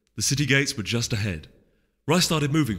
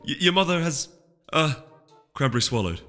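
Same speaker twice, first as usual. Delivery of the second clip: much higher, upset and hesitant; anxious young mare; 6 semitones higher, 3 dB louder than usual. Acted. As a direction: blurt bad news nervously. Cranberry upset with news.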